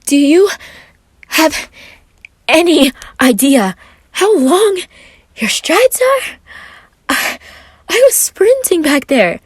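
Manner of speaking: huffy little outburst; adorable tantrum. Acted. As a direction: stomp through it like a pouting kid. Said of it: "had somewhat of an adorable tantrum".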